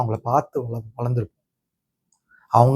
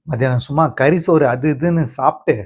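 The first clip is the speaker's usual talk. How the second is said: ordinary talk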